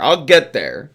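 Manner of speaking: usual speaking voice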